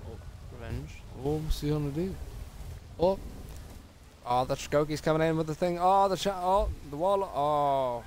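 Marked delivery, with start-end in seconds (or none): 1.3-8.0 loud, rising live reaction; excited. Real, not acted. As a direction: call the fight as it happens.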